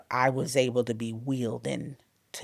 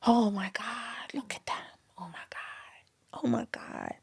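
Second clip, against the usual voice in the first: awed whisper; 6 semitones higher, 8 dB quieter than usual. Retold or imitated. Mother seeing her tiny babies, tender awed whisper. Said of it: "I just remember smiling".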